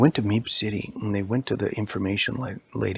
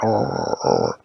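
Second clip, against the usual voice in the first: louder, dropping zombie arr; zombie groan; 4 dB louder than usual. Acted. Headless body wanders around, zombie groans. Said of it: "wandering around like a zombie".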